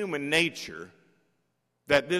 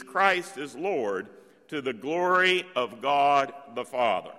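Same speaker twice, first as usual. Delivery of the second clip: solemn scripture reading; 5 dB louder than usual. Acted. Reading the hymn aloud, loud.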